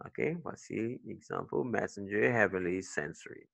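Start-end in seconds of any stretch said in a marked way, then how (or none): 1.6-3.5 "messenger", "heavenly", "sensory", each led by its first syllable; first-syllable stress. Shown as a demonstration. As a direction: softly list "messenger", "heavenly", "sensory", stressing MESS, HEA, SEN. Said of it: "Stress is on the first".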